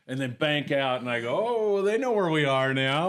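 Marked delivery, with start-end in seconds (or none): 1.5-3.1 higher, louder mock awe; retold amazement. Skipper seeing the jet buzz over, retold loud amazement. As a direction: let it rise loud, retelling your amazement.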